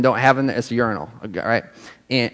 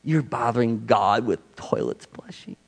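softer, slow scoffing voice; scoffing shoulder voice; 3 semitones lower, 8 dB quieter, slower than usual. Retold or imitated. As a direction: sneer like a doubting little voice. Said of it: "voice on my shoulder going".

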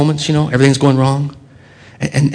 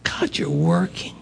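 soft, higher, awed; hushed amazement to God; 6 semitones higher, 13 dB quieter, much slower than usual. Retold or imitated. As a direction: whisper it in wonder. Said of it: "And I go".

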